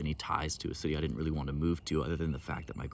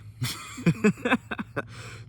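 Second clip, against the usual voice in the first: laughs; 4 dB louder than usual. Real, not acted. Laughing at own silliness.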